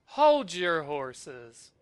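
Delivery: drawn-out commanding call; slow loud halt. Acted. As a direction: stretch the words out to stop them cold. Hero halts the attackers.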